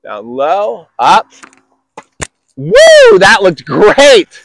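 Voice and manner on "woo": excited whoop. Real, not acted. Coach cheers great shot.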